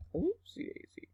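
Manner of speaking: tiny high, soft squeak; oopsie. Real, not acted. Teacher reacting to a slip mid-lesson, high soft oopsie. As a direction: let it slip out high and quiet.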